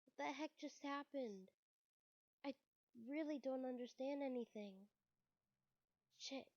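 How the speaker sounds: ordinary talk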